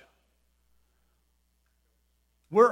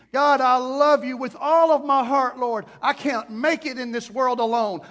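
building, louder, higher cry to God; impassioned plea; 4 semitones higher, 5 dB louder than usual. Acted. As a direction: plead urgently with God, building in force. Acted desperate heartfelt prayer to God.

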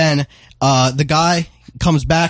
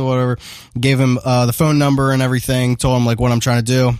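usual speaking voice

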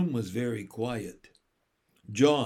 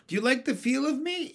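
high, quick, pleased question; pleased by praise; 10 semitones higher, faster than usual. A narrator's reading. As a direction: fish happily for more praise. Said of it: "as though it loved being praised".

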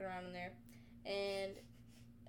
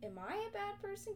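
1 mock worried question; 10 semitones higher than usual. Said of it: "then you're like".

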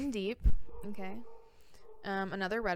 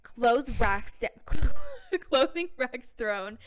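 put-on slow, laughing California valley girl drawl; California accent; 3 semitones higher, 7 dB louder, slower than usual. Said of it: "Oh, wait, I forgot my accent".

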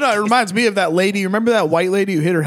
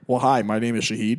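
low, soft, ordinary-guy voice; imagined accentless self-introduction; Shahid without accent; 9 semitones lower, 5 dB quieter than usual. Pretend. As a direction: introduce yourself in a deadpan normal voice. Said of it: "talks like a normal fucking guy".

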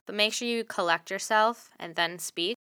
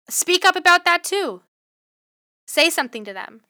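pitched up high and loud; emphatic call; 10 semitones higher, 6 dB louder than usual. Real, not acted. Podcast host urging listeners to speak up, loud and high. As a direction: urge them to speak up, raised voice.

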